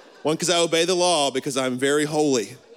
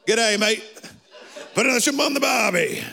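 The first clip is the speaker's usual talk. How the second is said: laconic outback drawl; 3 semitones higher than usual. Retold or imitated.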